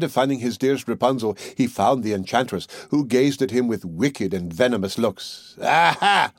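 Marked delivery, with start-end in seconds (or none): 5.6-6.3 higher, louder gloating aha; mocking cry. A narrator's reading. Enchantress catching the king's son at the tower, gloating higher cry. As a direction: crow over the trapped prince. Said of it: "she cried mockingly".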